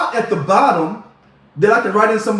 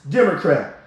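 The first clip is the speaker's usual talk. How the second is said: ordinary delivery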